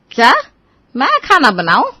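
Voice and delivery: loud, startled disbelief; mother-in-law aghast at cooking duty; shocked. Acted. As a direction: recoil at being asked to cook. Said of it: "इसमें चौंकने की क्या बात है".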